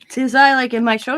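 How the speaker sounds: can't believe it, asking back; surprised question. Retold or imitated. Grandma to granddaughter about her career, surprised.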